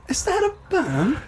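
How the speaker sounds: slow, high mock English accent; bad English accent. Co-host joining the joke, bad English accent. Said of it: "that's a bad English accent".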